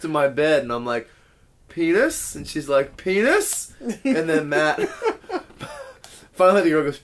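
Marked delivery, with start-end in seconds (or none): 1.7-2.3 higher, rising question; escalating call. Retold or imitated. Storyteller answers the girl creeping closer, louder rising call. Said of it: "it starts getting louder". 3.0-3.7 high, sharply rising question; escalated call. Retold or imitated. Girl answers as the game gets louder, high rising call. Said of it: "it starts getting louder".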